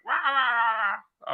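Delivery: imitating his own outburst, high and wild; mock angry roar. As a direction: bark it like a raging animal. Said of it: "and I was like".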